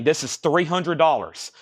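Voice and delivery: ordinary delivery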